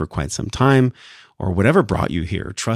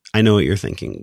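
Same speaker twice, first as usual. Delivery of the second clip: ordinary delivery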